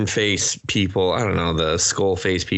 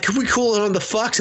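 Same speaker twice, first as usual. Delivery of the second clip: high put-on exasperated voice; mock exasperated woman; 14 semitones higher than usual. Woman asking the men to stop swearing, high mock voice. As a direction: plead for less swearing.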